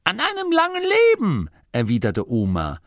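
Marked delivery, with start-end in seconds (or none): 0.1-1.5 put-on high, much higher old woman's tart reply; dry old Oma. A narrator's reading. Oma Morky answering Masklin, tart and high. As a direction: toss it off like a know-it-all granny.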